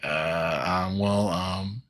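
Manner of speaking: halting, mumbly stammer of stage fright; imitating choking up. Donatello freezing under the interviewer's questions, mock hesitant stammering. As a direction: stall lamely with drawn-out hesitation. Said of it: "The turtles start choking".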